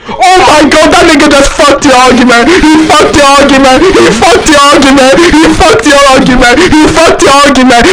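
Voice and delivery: loud, high, laughing mockery; gloating shout. Real, not acted. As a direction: shout it in triumph. Gloating over opponent's lost argument.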